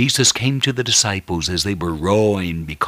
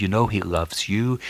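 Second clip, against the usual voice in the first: normal talking voice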